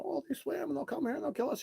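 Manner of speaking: high, whiny mock-scared voice mocking the crew; mocking cowards. Host mocking the show's frightened crew, whiny and high. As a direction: squeak it out like a scaredy-cat. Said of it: "the cowards start talking".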